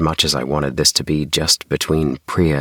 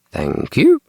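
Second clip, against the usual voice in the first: smug; 4 dB louder than usual. Acted. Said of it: "Mum said smugly".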